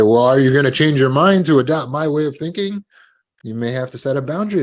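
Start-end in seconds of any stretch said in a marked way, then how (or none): 0.0-2.8 pushy challenge. Retold or imitated. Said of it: "And if they say".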